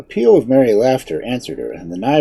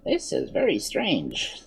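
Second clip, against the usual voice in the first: slow, quiet grumble, pitched up; surly; 8 semitones higher, 7 dB quieter, slower than usual. A narrator's reading. Wizard finding his piglets invisible, surly. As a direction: say it surlily, drawn out and soft. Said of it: "he said surlily".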